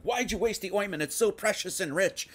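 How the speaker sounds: lightly mocking scolding; mocking Pharisees. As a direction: sneer it like a petty scribe. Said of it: "these scribes and Pharisees are going around being like".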